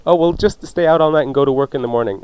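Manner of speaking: ordinary delivery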